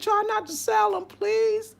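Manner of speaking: mock pleading to the bag maker; pleading request. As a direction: beg him sweetly not to sell. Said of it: "I said".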